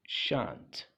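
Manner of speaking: शांत repeated as "shaant", one low falling syllable; long-vowelled "shaant" for शांत, pitched low; correct pronunciation. Shown as a demonstration. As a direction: model शांत for students with no l. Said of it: "That is".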